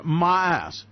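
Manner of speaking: ordinary talk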